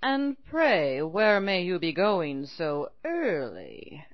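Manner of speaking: slow, sly wolf voice; smooth talking wolf. Acted. Wolf asks the girl.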